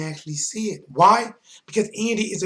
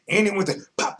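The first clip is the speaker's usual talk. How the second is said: usual speaking voice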